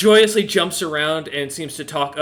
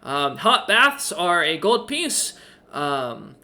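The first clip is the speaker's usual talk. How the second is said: high, eager little owl voice; chirpy owl innkeeper; 6 semitones higher than usual. Acted. Owl innkeeper welcoming Roloman, high and eager. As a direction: play the helpful owl host, pitched up.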